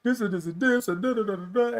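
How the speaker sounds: fast, high, rushed protest; pleading protest. Retold or imitated. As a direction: tumble out excuses in a high rush. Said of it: "And I was like".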